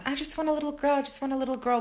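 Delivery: high-pitched mock pleading; high, singsong imitation of a wish; mimicked wishing. Mimics sister wishing for a girl.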